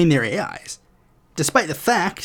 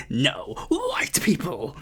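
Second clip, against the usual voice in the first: slow, quieter, mocking put-on voice of a dismissive governor; mock governor reply; 6 dB quieter, much slower than usual. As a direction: drag it out like a smug official brushing him off.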